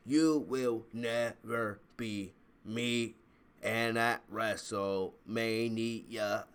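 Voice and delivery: slow, mechanical robot monotone; Charlotte Flair robot voice. Retold or imitated. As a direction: speak like a slow malfunctioning robot. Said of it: "she's just so robotic".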